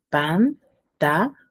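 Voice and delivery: "Pantalones" in four slow beats, pan - ta - lo - nes; syllable by syllable. Shown as a demonstration. Teacher asking how many syllables, "Pantalones" split by syllables. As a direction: pause clearly between all four syllables of "Pantalones". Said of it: "cuántas sílabas tendrá".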